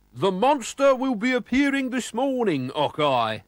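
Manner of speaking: high, loud fake Scottish voice; false Scottish accent. A narrator's reading. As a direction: put on a phoney Scottish accent to fool him. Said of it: "said McBurp with his false accent".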